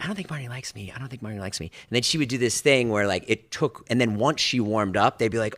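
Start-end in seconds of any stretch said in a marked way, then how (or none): 0.0-1.7 insecure mutter. Retold or imitated. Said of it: "they would be like".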